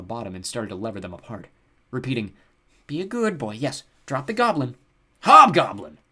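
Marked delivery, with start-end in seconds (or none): 2.9-4.8 higher, singsong and drawn out; slowing, encouraging pet talk; coaxing an animal. A narrator's reading. Simon coaxes the griffin. 5.2-6.0 loud, high goblin yelp; sharp goblin outburst. A narrator's reading. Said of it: "Hogsquill yelped".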